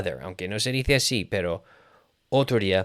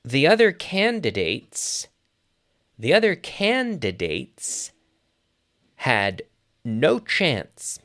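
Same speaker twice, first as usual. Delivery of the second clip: slow model sentence; 3 semitones higher, slower than usual. Shown as a demonstration. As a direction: dictate it chunk by chunk for learners. Teacher models the translation in chunks.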